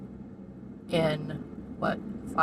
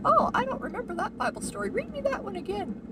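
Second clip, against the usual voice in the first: put-on higher, sweet elderly lady asking; old lady's plea; 14 semitones higher than usual. Narrator quoting the elderly lady, asking sweetly. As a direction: lightly voice the old woman begging. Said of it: "So the lady would say".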